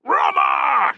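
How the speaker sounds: loud shouted Roma; soldiers' battle cry. Acted. Legion shouting Roma, loud. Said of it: "shouted his men".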